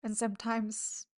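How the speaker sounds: usual speaking voice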